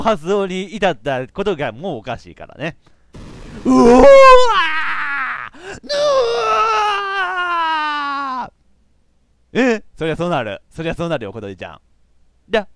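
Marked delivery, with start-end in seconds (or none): 3.6-9.6 long, high, roaring scream; drawn-out battle yell; all-out scream. Acted. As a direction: scream at full force and hold it. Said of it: "叫ぶか".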